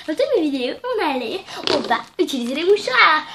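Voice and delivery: hammed-up host opening her video on tissues; mock intro. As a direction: parody a chirpy video host. Mock YouTuber intro about tissues.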